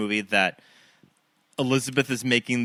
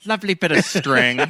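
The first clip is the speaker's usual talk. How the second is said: laughing echo; 5 semitones higher, 3 dB louder than usual. Retold or imitated. Echoing queen voice laughing.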